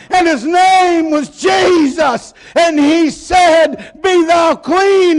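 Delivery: joyful shout. Retold or imitated. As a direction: shout out the healing story, slow on Jesus. Healed leper testifying loudly.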